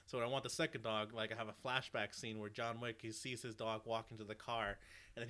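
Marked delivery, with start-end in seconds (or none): none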